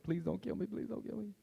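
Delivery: hammed-up terrified teen begging for his life; mock pleading. Son begging his mom not to kill him, mock pleading.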